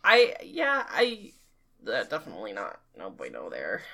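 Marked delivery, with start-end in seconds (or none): 1.9-3.8 low, soft mock disapproval; deep mock voice. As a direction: drop into a deep joking voice.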